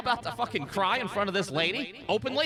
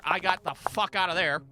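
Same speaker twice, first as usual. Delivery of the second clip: ordinary delivery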